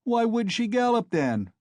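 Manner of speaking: high-pitched shouted retort; defiant shout. A narrator's reading. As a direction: throw it back at the crowd, high. Mikolka answers the crowd, shouting.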